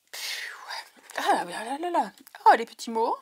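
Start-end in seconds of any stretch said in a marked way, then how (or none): 0.1-2.1 soupir soufflé, presque sans voix; submergée par l'émotion, tout bas; chuchoté. Real, not acted. Overwhelmed by gift. (souffle, dépassée par l'émotion)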